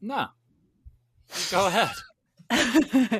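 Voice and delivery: high, drawn-out playful insistence; mock insistent. Urging his co-host to go first, high and drawn-out. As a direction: stretch it out, teasing.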